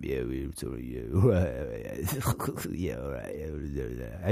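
low, soft mumbled phone chat; mumble chat. Retold or imitated. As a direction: mumble into the phone, barely there. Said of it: "having a sort of mumble chat".

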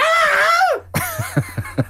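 high manic scream as a greeting; mock screaming. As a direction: answer the phone with a wordless shriek. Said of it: "when he answers the phone".

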